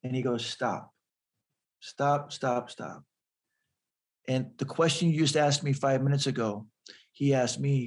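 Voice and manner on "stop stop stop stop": low, hushed repeated stop; quiet interruption. Retold or imitated. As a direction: softly halt someone mid-confession, pausing between words. Priest cuts off the confession.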